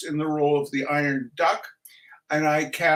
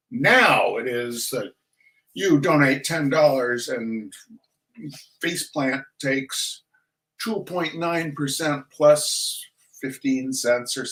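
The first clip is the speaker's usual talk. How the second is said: normal talking voice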